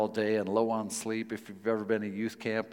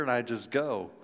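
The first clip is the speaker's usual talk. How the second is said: ordinary delivery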